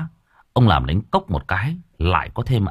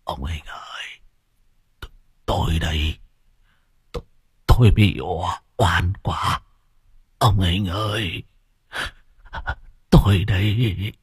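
faint, halting, weepy voice; weak, tearful whimper. Acted. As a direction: wheeze it out through sobs, barely any breath. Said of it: "khóc lóc kể lể bằng cái giọng thều thào".

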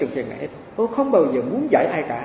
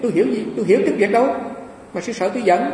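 ordinary delivery

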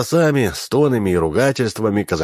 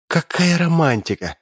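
excited gasp; 9 semitones higher than usual. A narrator's reading. Lika marvels at the screen.

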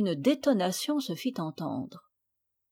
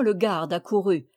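usual speaking voice

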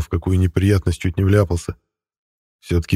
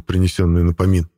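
usual speaking voice

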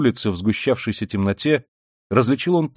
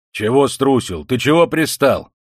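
louder, higher angry retort; indignant; 4 semitones higher, 6 dB louder than usual. Acted. Yardkeeper answering Raskolnikov's jibe, indignant. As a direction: throw the taunt back crossly. Yardkeeper quarrels back at the taunt.

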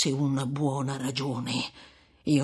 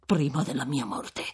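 plain reading voice

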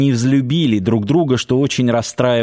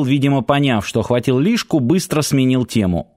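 ordinary delivery, nothing marked

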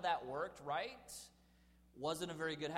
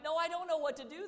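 much higher, loud and fast; frantic customer refusing to calm down; panicked wail; 11 semitones higher, 7 dB louder, faster than usual. Retold or imitated. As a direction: blurt it out in a panic. Irate woman keeps ranting.

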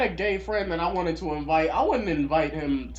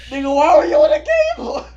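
high, loud shout cracking with laughter; laughing shout; 10 semitones higher, 7 dB louder than usual. Real, not acted. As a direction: yell the question through laughter.

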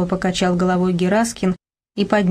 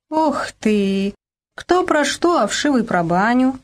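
spiteful scoff, jumping higher; angry snort; 6 semitones higher, slower than usual. A narrator's reading. Zinaida scoffing at talk of a memorial, spitefully. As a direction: scoff it with venom. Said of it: "зло фыркнула Зинаида".

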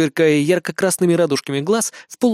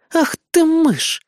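exclaiming; 13 semitones higher, 4 dB louder, much slower than usual. Acted. Azumi exclaiming.

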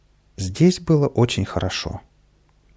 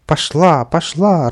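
louder, higher gleeful cry; joyful shout; 6 semitones higher, 6 dB louder, slower than usual. A narrator's reading. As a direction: whoop as the water flies. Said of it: "радостно закричал Каприска".

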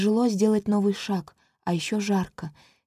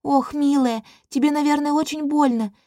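high, tender princess; cooing with pity; sympathetic; 6 semitones higher, 5 dB louder than usual. A narrator's reading. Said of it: "сочувственно смотрит".

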